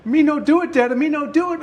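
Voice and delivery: high, loud toddler protest, acted; imitated little boy. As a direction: mimic a small child pleading innocence in baby talk. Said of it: "And Henry's like".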